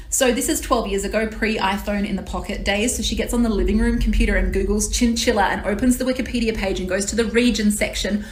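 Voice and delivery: ordinary talk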